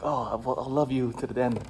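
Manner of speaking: soft, sugary lovers' promise; mock fluffy. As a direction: make it sound sweet and empty. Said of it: "that's all very fluffy".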